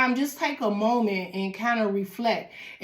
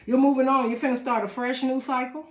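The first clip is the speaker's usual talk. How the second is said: usual speaking voice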